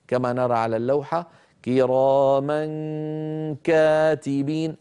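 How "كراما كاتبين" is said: كراما كاتبين slowly, the nasal of the tanween hidden before كاتبين; model recitation. Shown as a demonstration. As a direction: stretch كراما into كاتبين with a held hum. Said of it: "ننتقل من مخرج الميم إلى مخرج الكاف".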